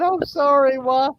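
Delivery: put-on high, whiny child's apology; mock crying. Scolded child apologizing to mom, mock crying. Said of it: "And I started crying".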